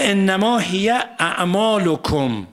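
slow, chanted Arabic verse; Quran recitation. Acted. Cleric reciting a verse to his audience, slow chant. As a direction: intone the verse slowly and solemnly.

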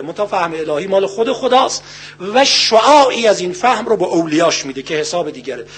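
ordinary talk, nothing marked

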